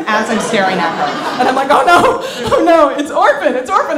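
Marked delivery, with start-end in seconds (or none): 1.7-4.0 loud, high-pitched alarm, played for laughs; mock panic. Stoned panic at seeing the woman.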